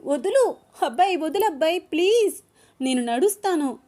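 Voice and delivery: flustered cry to be put down; flustered protest. A narrator's reading. Wife being carried, flustered and squealing to be put down. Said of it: "కొంచెం ఇబ్బంది పడుతూ".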